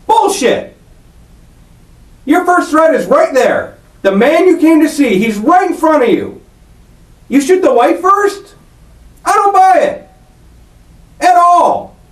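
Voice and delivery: high-pitched, emphatic incredulity; real indignant disbelief. Real, not acted. Interrogator rejecting suspect's account of shooting the wife, indignant. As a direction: pitch jumps up, hammering each phrase in disbelief.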